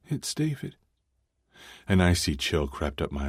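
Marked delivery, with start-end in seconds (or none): 0.1-0.7 female voice. Acted. Tiffany voiced on phone.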